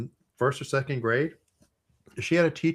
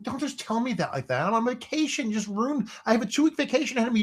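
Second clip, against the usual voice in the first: much higher, rushed, outraged complaint; exasperated whine; 11 semitones higher than usual. Retold or imitated. Vacationer scolding his brother for blunt news, high indignant whine. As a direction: squeal it like your vacation is ruined.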